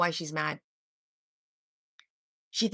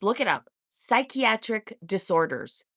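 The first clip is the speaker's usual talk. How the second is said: ordinary delivery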